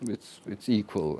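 ordinary talk, nothing marked

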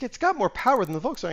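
high, fast, insistent; imitating the saleswoman. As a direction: rattle it off like the pushy saleswoman. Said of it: "She's like".

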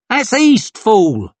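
high, loud correction; scornful shout. A narrator's reading. Onlooker corrects the panicked man, scornful shout. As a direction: shout the fool down.

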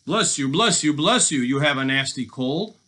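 gloating storybook robber; mocking sympathy. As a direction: pop out each blessing in mockery. Robber taunts his sneezing captive.

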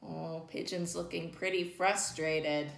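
plain reading voice

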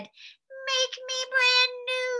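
high, slow, drawn-out little voice; puddle voice. A narrator's reading. Melted snowman calling from the puddle, high and slow. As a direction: hold it high and stretch every word. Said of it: "A voice from the puddle said".

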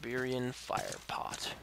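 slow whispered item name; whispered read. Real, not acted.

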